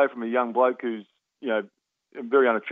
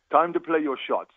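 put-on slow, higher West Indian accent; 4 semitones higher, slower than usual. Psychologist advising player at half time, West Indian accent. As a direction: deliver it slowly in an imitated West Indian lilt. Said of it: "trying to do my West Indian accent".

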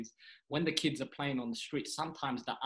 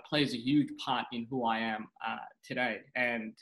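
usual speaking voice

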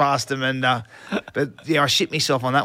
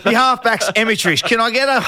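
higher, louder mock coach's spray; coach's yell; 8 semitones higher, 5 dB louder than usual. Coach Gus spraying his halfback in a video session, shouting. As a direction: bark it like a furious coach. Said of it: "Gus is into me".

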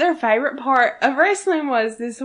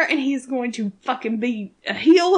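ordinary talk, nothing marked

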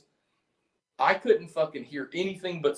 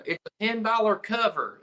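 put-on door lady's Southern accent; 8 semitones higher than usual. Imitating the door lady's Southern accent at the bar. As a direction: mimic the bar lady's Southern accent, not quite right. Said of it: "I do the accent kind of wrong".

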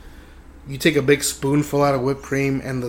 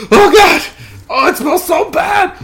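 very high, strained mock outrage; agonized cursing; 14 semitones higher, 6 dB louder, slower than usual. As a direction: wail and curse at the stench. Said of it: "cursing Dallas's name".

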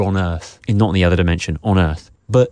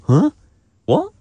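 mock baffled grunts with rising tune; confused little question noises; puzzled reaction; 4 dB louder than usual. Guest imitating his own confusion watching the film, puzzled. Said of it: "I felt like I had that".

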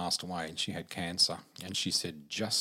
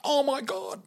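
high, loud mock shriek; overwhelmed outburst, pitched way up; imitated losing it; 6 dB louder than usual. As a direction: act out her losing it completely. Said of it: "And she lost it".

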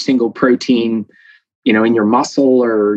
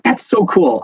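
high, excited gush; geeky delight; 8 semitones higher than usual. Retold or imitated. Thinking about body tissue renewal, high excited delight. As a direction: gush like a science nerd. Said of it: "I'm like".

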